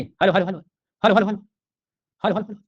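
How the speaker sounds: loud repeated hello, pitch climbing; calling out. Real, not acted. Teacher loudly calling hello to wake up dozing students. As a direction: call out hello to wake the sleepy class. Teacher waking up sleepy class.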